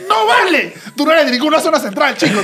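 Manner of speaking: high laughing protest. Real, not acted. Teasing her friend with laughing mock outrage.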